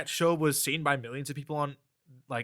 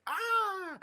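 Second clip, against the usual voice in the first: imitated scream. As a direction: let out a thin, high "ah" imitating her panic. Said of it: "that's when she's like".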